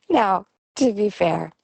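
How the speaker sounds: usual speaking voice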